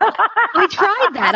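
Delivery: laughs through slow, stretched words; laughing. Real, not acted. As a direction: laugh it out while agreeing. Laughing while agreeing with host.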